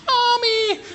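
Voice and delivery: put-on high wailing child calling for mom; crying child. Preacher imitating a frightened child calling mommy, high wail. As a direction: cry out like a scared little kid.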